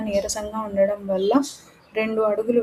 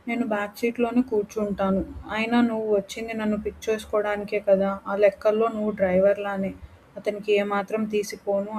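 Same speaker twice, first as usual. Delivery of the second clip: ordinary delivery, nothing marked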